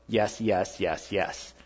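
a gentle steady voice that won't let go; Holy Spirit's voice. Retold or imitated. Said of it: "what I heard the Holy Spirit go".

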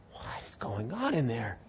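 hushed, breathless whisper; whispered question. Acted. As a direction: whisper it like eavesdroppers at the door. Egyptians outside hearing weeping.

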